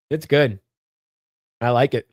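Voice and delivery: ordinary delivery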